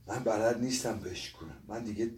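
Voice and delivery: soft, defeated, near tears; choked up. Acted. Old man confessing he has no strength left, choked up. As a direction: let the voice sink as strength runs out.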